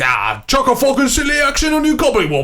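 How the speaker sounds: high, garbled mock Irish accent. Host joking about the film being Irish, mock Irish accent. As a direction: gabble nonsense in a hammed-up Irish accent. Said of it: "and I'm like".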